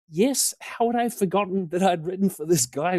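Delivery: high-pitched, exaggerated disbelief; hammed-up incredulous reply; mock incredulity. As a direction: play up how absurd the question is. Mock disbelief at an absurd question.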